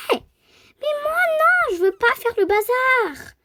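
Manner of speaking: cri aigu et fort, voix poussée; elle proteste en criant; cri de protestation. Pretend. Refuse de faire le bazar. (cri aigu de refus, voix d'enfant)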